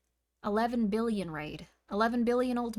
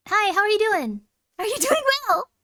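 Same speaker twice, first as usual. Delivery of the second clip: much higher, louder bubbly voice with a laugh; squeaky cutesy greeting; 11 semitones higher, 11 dB louder than usual. Pretend. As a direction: greet them in a silly squeaky voice. Greets viewer in put-on voice.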